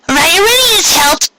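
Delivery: put-on high, bouncy young pony voice; a cheerful young mare; bubbly Pinkie voice. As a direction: chirp it out like an eager filly. Pinkie answers her teacher cheerfully.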